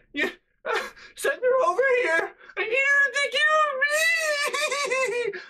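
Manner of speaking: very high, loud wailing plea, broken by laughs; hammed-up sobbing through giggles; mock crying plea. Sister begging over the phone for mom, mock crying.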